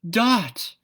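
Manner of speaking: loud, high joyful cry. A narrator's reading. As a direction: call out to the lost daughter. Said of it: "cried her father".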